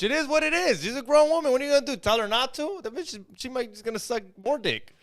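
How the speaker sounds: high, loud mock voice of a pimp talking on a podcast; Sharp impression. Friend mocking a podcast guest for his pal, high and loud. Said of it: "This is what Sharp talks like".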